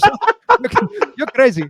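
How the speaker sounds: put-on high-pitched, loud Indian accent. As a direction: play the neighbor in an Indian accent, amazed. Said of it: "I even showed him my Indian accent".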